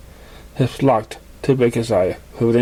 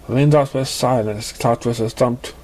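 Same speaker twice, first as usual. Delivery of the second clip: usual speaking voice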